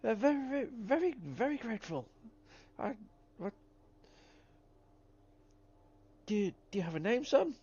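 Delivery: put-on crackly grateful old-timer; old prospector. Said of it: "an old prospector, uh, voice".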